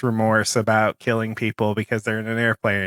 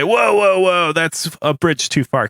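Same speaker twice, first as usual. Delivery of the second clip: high, loud, drawn-out whoas easing down; imitated objection; 4 semitones higher, 3 dB louder than usual. People objecting to Kevin's argument, protesting. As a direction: cut in to halt someone. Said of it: "And people say".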